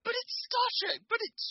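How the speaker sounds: squeaky high tormented whine; anguished falsetto. Retold or imitated. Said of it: "it was like".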